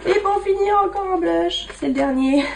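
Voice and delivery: voix plus aiguë, rieuse; amusée. Real, not acted. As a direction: annoncer le dernier en riant, plus haut. Avoue en riant sa razzia de blushs. (amusé, un rire dans la voix)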